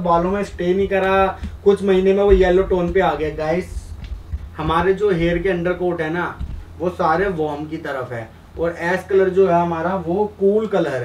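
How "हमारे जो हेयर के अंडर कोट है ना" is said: ordinary delivery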